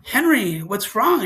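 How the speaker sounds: retold urgent check on a child; alarmed father. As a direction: call out to your son in alarm. Father rushing to shouting son.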